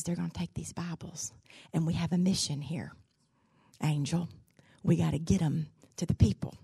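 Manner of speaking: hushed, secret plea to an angel; whispered prayer. Acted. Smuggler praying to an angel at customs, whispering. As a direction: whisper it so the guards can't hear.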